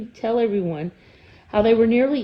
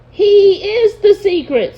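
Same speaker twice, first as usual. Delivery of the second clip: much higher, louder, slow troll voice; emphatic troll; 12 semitones higher, 10 dB louder, slower than usual. Acted. Mard insisting to Bork, high and slow. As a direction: lean in hard on each word.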